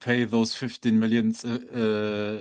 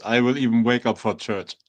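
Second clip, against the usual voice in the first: ordinary delivery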